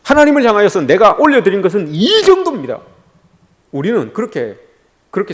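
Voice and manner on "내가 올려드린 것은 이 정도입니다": higher-pitched show of one's offering; boasting of offerings. Acted. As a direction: present it to God as something grand. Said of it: "하나님 앞에 올려드리는 것은".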